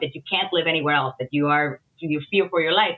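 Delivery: normal talking voice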